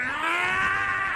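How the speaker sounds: sudden high yelled boo; scary shout. Pretend. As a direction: leap out and scare them. Jump scare knock-knock.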